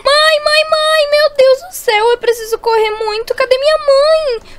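high, frantic calls for mom; panicked calling. Acted. Girl running to find her mother, panicked calling. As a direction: call out in a high, distressed pitch.

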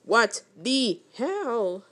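very slow, dragged words; drawn-out what the hell. Real, not acted. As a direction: stretch every word out. Drawn-out exclamation.

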